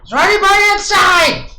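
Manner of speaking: high-pitched holler for anyone inside; high searching yell; calling out. Pretend. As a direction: call out to whoever might hear. Calling into the walled town.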